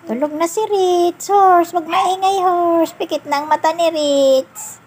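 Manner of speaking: put-on high sing-song voice for a toddler; high baby talk. Caregiver lulling Ritz to sleep with the toy horse, high sing-song. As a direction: coo it up high like talking to a little one.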